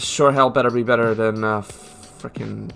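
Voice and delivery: ordinary talk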